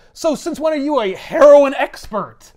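loud, high needling jabs; teasing accusation. Retold or imitated. Spider-Man needling Felicia, teasing accusation. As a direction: rib her about her knowledge. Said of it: "he's like".

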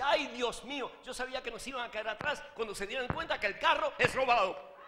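loud, high comic alarm; hammed-up startled outcry; mock startled. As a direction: wail it like a mother-in-law jolted awake, building to the punchline. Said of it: "de un sobresalto".

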